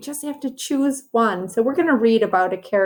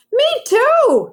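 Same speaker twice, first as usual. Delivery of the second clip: high, loud chirpy "me too"; eager child; 5 dB louder than usual. A narrator's reading. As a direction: jump in eagerly like a little boy. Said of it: "said Carlos".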